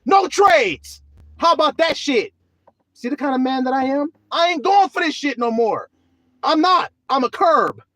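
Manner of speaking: high, loud angry refusal; defiant shout. Acted. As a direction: spit back the refusal at the swindler. Preacher defiant, rejecting trade.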